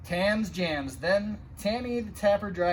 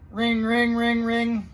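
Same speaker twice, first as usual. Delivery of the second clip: high, loud imitated bell ring; 6 semitones higher, 7 dB louder, slower than usual. A narrator's reading. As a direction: ring it out like an alarm. Fire alarm bell rings.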